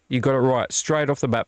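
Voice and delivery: ordinary talk, nothing marked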